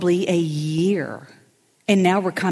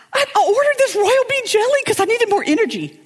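much higher, fast frantic voice; higher frantic storyteller friend; high panicked friend; 5 dB louder than usual. Retold or imitated. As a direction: rattle it off in a high fluster. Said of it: "She goes".

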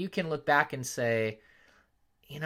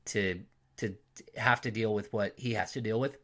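usual speaking voice

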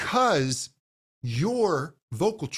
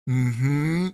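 a hum sliding upward; rising mm-hmm; 3 dB louder than usual. Shown as a demonstration. Coach humming mm-hmm to find where it vibrates, sliding upward. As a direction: slide the mm-hmm up to find the buzz. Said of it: "as I feel that slide up".

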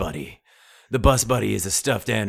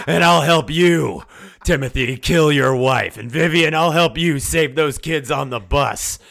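hyped sales pitch; 8 semitones higher, 8 dB louder than usual. Acted. Sponsor promising help to each family member, loud. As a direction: sell it hard, building to a yell.

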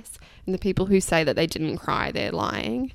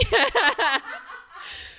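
laugh. Real, not acted. Laughing at own joke.

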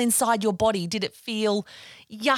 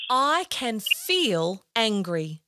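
slow affirmation; 2 semitones lower, much slower than usual. Shown as a demonstration. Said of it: "Can you say it with me?".

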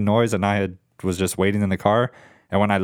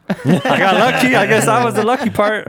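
laughing; 12 semitones higher, 5 dB louder than usual. Real, not acted. Laughing at his own luck.

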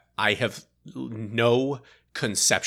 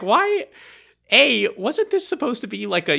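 much higher, louder baffled why; exasperated why; 13 semitones higher, 4 dB louder than usual. Retold or imitated. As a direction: throw up your hands on the word. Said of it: "the whole time I'm like".

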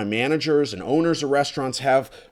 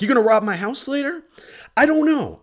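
incredulous; 14 semitones higher, 4 dB louder than usual. Real, not acted. Ranting at nosy neighbor.

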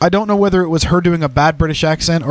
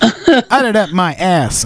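very slow commentator impression; 3 semitones higher, much slower than usual. Describing a music remix, drawn-out mock commentator voice. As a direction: stretch it out like a remixed commentator clip. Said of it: "he's literally talking like".

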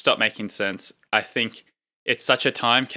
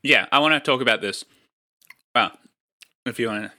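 talking while chewing; mouth full; 4 dB louder, faster than usual. Real, not acted. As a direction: speak through a mouthful of food. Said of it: "Are you gonna talk with your mouth full".

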